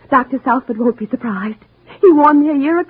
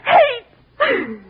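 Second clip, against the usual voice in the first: tearful outburst; 11 semitones higher than usual. Acted. Wife's gloating breaks into tears.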